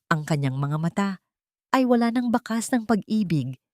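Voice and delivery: plain reading voice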